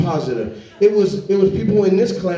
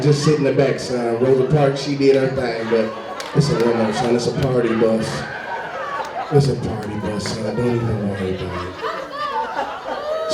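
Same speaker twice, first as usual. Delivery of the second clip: low, easy reassuring dad; lightly voiced calm father, lower; 7 semitones lower, 3 dB quieter than usual. Father calmly telling his son the bus is a limo.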